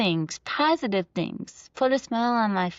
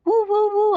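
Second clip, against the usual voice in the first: high, loud woo-woo siren noise; siren imitation; 13 semitones higher, 6 dB louder than usual. Son copying the ambulance siren, high and loud.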